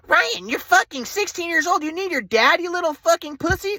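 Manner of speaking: high, slow sneering mother's voice; mocking mom. Mom insulting her teenage son, high sneering voice.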